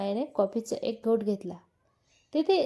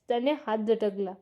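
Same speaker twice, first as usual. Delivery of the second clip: ordinary talk, nothing marked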